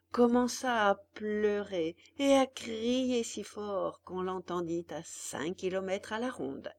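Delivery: ordinary delivery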